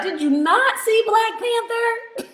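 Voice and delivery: high, teasing challenge; mock indignation. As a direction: playfully scold the listener. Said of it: "She called me Nana".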